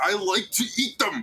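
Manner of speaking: put-on high, stubborn childish whine; high wizard voice. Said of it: "He's like".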